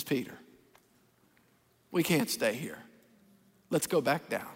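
low, soft and gentle; calm Jesus. Acted. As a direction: settle him down kindly. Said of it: "Jesus looks at Peter and says".

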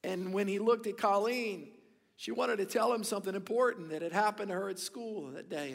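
ordinary talk

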